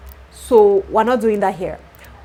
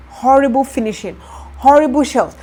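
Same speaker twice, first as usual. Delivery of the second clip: indignant outburst; 5 semitones higher, 7 dB louder than usual. Real, not acted. Venting about the bad work.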